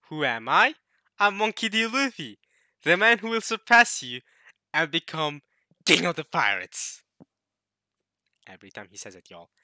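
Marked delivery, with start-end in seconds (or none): 0.1-7.0 defiant shout. Acted. Reading Luffy's defiant declaration.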